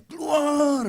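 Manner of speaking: "Keluar" yelled high, with a beginner's strain; mock novice shout of "Keluar" at a demon. A pastor imitates a beginner ordering a demon out, yelling "Keluar". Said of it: "pakai teriak-teriak, namanya juga baru pemula".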